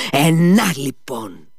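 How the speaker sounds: loud burst that drops away; wild yell. A narrator's reading. Captain stamping on the money, yelling. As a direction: spit it out in fury. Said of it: "ξεφωνίζοντας και λαχανιάζοντας".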